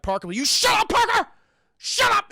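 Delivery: very high, loud yell; angry shouting. Retold or imitated. Ripley silencing a ranting crewmate, furious shout. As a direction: shout someone down, voice shooting up.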